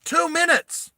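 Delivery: high mom voice calling out; mum yelling. Acted. Mum answering her son from the kitchen, high and mock yelling. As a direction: shout from the kitchen. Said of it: "Yells out from the kitchen".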